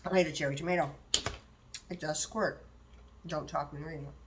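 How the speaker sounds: talking with a mouth full. Real, not acted. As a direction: speak around a bite of tomato.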